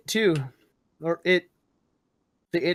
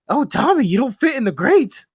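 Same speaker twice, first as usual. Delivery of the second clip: high, loud teasing clown; Pennywise voice; 6 semitones higher, 6 dB louder than usual. Retold or imitated.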